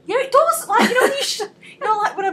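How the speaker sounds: high squealing laugh through the words. Real, not acted. Laughing at her friend's doubt, high and loud.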